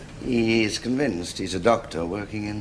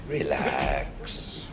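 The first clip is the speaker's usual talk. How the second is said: Relax said much lower and softer, drawn out; lower, softer soothing relax; 11 semitones lower, 5 dB quieter than usual. Pretend. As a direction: sink the word Relax down low and soft.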